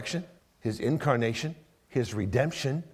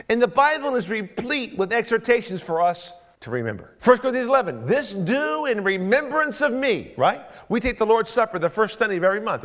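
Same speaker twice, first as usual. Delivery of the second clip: raised, rousing preacher's cadence; preaching; 11 semitones higher, 9 dB louder than usual. Acted. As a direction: preach it out to the congregation. Preacher building to full force.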